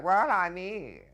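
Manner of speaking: high, loud dithering actor voice; hesitant Kent voice. Retold or imitated. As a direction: stammer out the lines like a fussy old-fashioned actor. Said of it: "And I had these lines, like".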